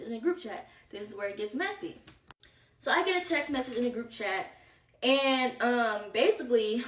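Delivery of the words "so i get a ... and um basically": ordinary talk, nothing marked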